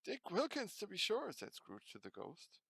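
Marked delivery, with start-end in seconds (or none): 0.1-1.3 high, bright cry of recognition; delighted. Acted. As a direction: remember him with joy.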